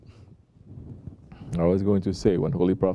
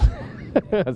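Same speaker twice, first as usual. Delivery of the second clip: short high laugh; amused chuckle; 11 semitones higher than usual. Real, not acted. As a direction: chuckle briefly at your own story. Laughs at his own story.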